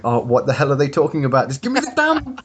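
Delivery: mock exasperation rising to a high demand; hammed-up impatient listener; exasperated entrants. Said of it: "have entered the competition are going".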